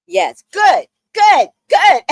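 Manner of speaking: high, loud shouted good good good; cheering approval. Retold or imitated. Men cheering her for cussing him out, shouting. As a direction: cheer them on. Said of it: "They were like".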